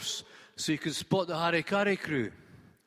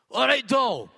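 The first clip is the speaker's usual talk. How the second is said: higher, louder, cheery Edinburgh cabbie; brash taxi driver voice, pitched up and loud; cabbie's hearty greeting; 9 semitones higher, 8 dB louder than usual. Acted. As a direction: call out over the rain to the fare. Cabbie greets passenger loudly.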